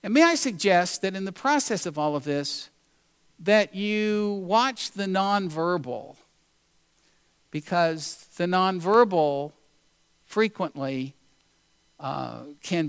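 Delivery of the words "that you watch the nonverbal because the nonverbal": slow emphatic urging. Real, not acted. Urging the listeners to watch.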